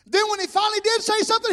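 loud, high preacher's cry; full-force preaching. Real, not acted. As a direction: preach it out at the top of the voice.

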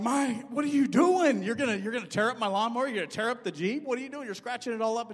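high, loud alarmed shouting at his wife; alarmed yell. Retold or imitated. Husband catching his wife loading the mower, shouting. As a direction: yell in shock at what she's doing.

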